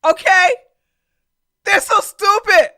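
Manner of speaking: loud, high exasperated yell; exasperated shout. Real, not acted. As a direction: yell it in disbelief. Exasperated outburst at protesters.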